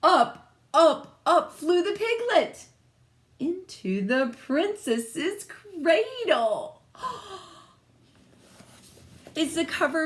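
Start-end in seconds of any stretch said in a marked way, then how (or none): 0.0-6.8 loud and high, building suspense, slowing at the end; dramatic read-aloud. A narrator's reading. Teacher reads the swap to children, loud dramatic build. 7.0-7.4 gasp. Real, not acted. Teacher gasps at the twist.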